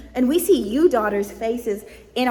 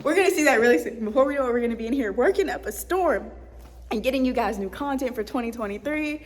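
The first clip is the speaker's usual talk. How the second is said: ordinary talk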